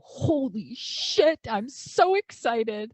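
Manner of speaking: high loud slow "Holy shit" full of delight; excited squeal. Retold or imitated. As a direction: shriek it out as a thrilled little girl. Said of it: "I go".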